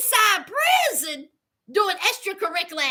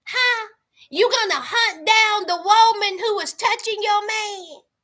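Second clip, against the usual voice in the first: ordinary talk, nothing marked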